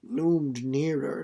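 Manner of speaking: usual speaking voice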